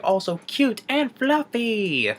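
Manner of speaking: high, slow cutesy alien voice; high drawn-out cute creature; Stitch voice. Retold or imitated. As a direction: coo it out smugly. Stitch retort.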